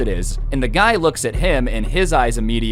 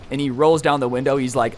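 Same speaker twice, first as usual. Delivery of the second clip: ordinary delivery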